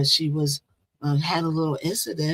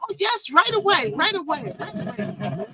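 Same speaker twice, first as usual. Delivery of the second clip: much higher, sing-song phone hello; mock eager foreigner; 11 semitones higher than usual. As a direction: play a foreigner suddenly all polite for money. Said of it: "They'd be like".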